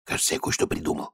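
fast, soft whisper; a quick hushed whisper. Acted. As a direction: whisper the idea to the boss. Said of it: "прошептал один из стражников".

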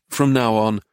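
plain reading voice

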